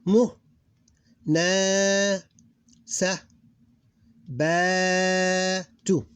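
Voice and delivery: each syllable sounded alone, pauses between. Shown as a demonstration. Teacher breaking a word down for learners, syllable by syllable. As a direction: read it out as separate syllables, long vowels held. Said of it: "read it in syllables".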